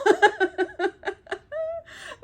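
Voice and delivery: long, high laughing fit; real high-pitched giggles; helpless laughter. Real, not acted. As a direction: crack up completely. Breaks up after saying meanwhile.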